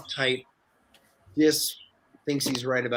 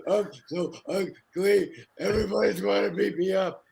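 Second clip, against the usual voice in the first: put-on whiny crying kid; 6 semitones higher than usual. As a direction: wail like a child in tears. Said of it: "I'm just like".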